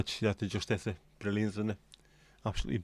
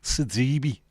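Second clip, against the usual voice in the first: Sidibe in higher Scouse; raised-pitch Scouse name; Scouse accent; 4 semitones higher than usual. Shown as a demonstration. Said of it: "Much better in a Scouse accent".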